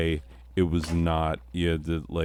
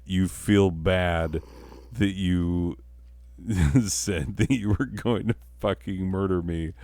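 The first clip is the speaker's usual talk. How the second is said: usual speaking voice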